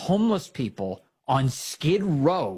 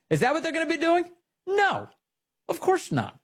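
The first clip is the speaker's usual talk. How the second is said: high mocking question, then a scoffing answer; sarcastic; 14 semitones higher than usual. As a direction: play innocent, then dismiss it. Mock question, sarcastic self-answer.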